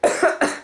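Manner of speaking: a dry, breathless cough; coughing on sprayed soda; real cough. Real, not acted. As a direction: cough as if the spray got in your throat. Choking on sprayed pop.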